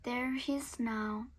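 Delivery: ordinary delivery, nothing marked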